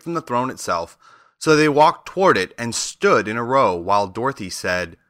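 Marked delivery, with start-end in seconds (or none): none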